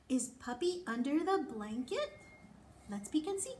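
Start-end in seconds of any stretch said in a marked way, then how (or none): none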